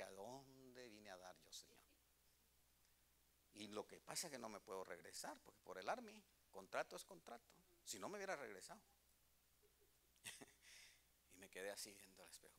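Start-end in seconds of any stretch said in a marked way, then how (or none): 0.0-1.8 soft, slow, forlorn plea; retelling a lost prayer to the Lord at the mirror; quiet despair. Retold or imitated. Alone in the bathroom, despairing. 11.3-12.5 quick hushed telling; hushed storytelling of a sad moment; whispered narration. A narrator's reading.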